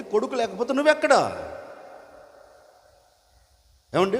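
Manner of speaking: high, ringing preacher's rebuke, pressing each question; full-force preaching. Acted. Preacher challenging the congregation about the daughter-in-law, in a high ringing preaching voice. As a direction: hammer the questions home from the pulpit.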